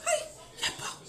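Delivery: breathy high whisper of aïe; whispered lament. Real, not acted. Preacher lamenting to the church, whispered high aïe.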